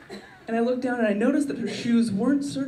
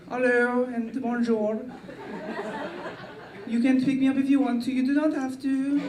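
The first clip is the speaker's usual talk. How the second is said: mock French charm, raised pitch; French accent; 4 semitones higher than usual. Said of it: "maybe a little bit more French".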